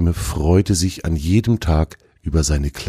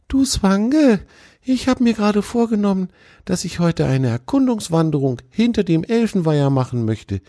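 much higher, eager fawn voice; eager young deer; 12 semitones higher than usual. A narrator's reading. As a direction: pitch the fawn high and hopeful. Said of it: "machte einen Vorschlag".

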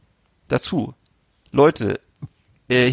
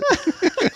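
lautes Lachen. Real, not acted. Lachen über Zigarette.

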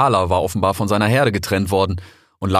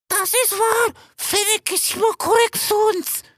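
very high, blurting call; eager young vulture. A narrator's reading. Vulture Erfan siding with the fox, eager call. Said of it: "rief da Erfan".

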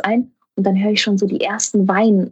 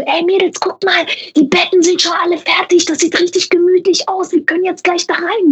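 coaxing; 10 semitones higher than usual. Retold or imitated. Coaxing crying colleagues to bed.

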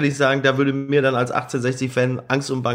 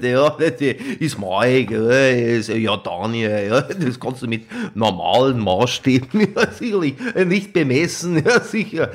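imitating Mike; 4 semitones lower than usual. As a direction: ham up a colleague's voice. Impersonating Mike, laughing. (parodierte Stimme von Mike)

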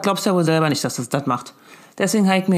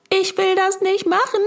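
put-on much higher, whining like a stubborn little kid; the brain throws a childish tantrum, pitched way up; whiny brain voice; 13 semitones higher than usual. The brain refuses the word problem, in a high whiny kid voice. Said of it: "mein Gehirn schaltet auf".

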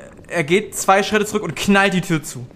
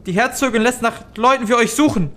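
frightened innkeeper; faster than usual. Acted.

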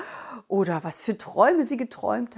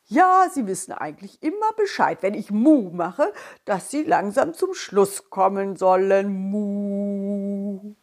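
cow voice; 3 dB louder than usual. Acted. Cow figure speaks.

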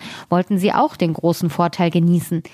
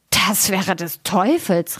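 slow, drawn-out astonished cry; miller's exclamation; slower than usual. A narrator's reading. Miller astonished at the raven's prophecy, exclaiming slowly. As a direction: exclaim it slowly, without raising the volume. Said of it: "rief der Müller".

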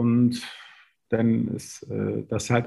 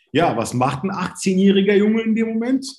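animated rhetorical question; 10 semitones higher than usual. Real, not acted.

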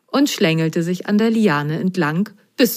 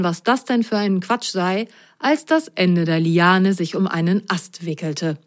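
plain reading voice

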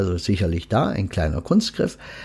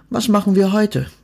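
higher, bright-spirited question; cheerful; 10 semitones higher than usual. A narrator's reading. Death cheerfully asking the duck about the day. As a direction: ask it in a good mood. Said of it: "fragte er gut gelaunt".

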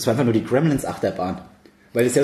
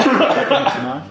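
mock dramatic; 9 semitones higher, 4 dB louder, slower than usual.

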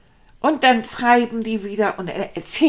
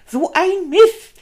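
so ein Mist, higher, louder and slow, stretching Mist; frustrated cry; 7 semitones higher, 6 dB louder, slower than usual. Acted. Rat sees the mess he made, mock frustrated cry. Repeated curse, louder take.

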